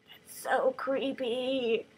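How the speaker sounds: slow, hushed whisper; creeped-out whisper. Real, not acted. Reader reacts to the sisters' plotting, slow whisper. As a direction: whisper it as a shuddering aside.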